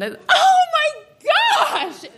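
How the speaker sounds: very high, drawn-out shriek; scandalized scream. Retold or imitated. Scandalized student getting the dirty joke, screaming. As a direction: scream it as the joke suddenly sinks in. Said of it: "And I screamed".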